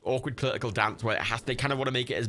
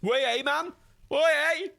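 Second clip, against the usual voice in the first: high, hammed-up Geordie cry; bad Newcastle accent; 5 dB louder than usual. Shown as a demonstration. Gamer trying an Ant and Dec impression, bad Newcastle accent. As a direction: badly fake a loud Geordie call, pitched way up. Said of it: "I can do, like, an Andeck".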